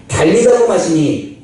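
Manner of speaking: Aramaic words said slowly and carefully; slow Aramaic phrase. Acted. 아람어 구절을 천천히 또박또박 읽는다. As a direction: draw out each syllable of "달리다고 마시니". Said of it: "번역하면 곧 내가 말하니".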